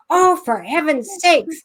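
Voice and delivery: grandmother's put-upon outburst, hammed up; exasperated grandmother. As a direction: play an exasperated old lady. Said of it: "my grandmother goes".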